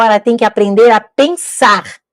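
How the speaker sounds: normal talking voice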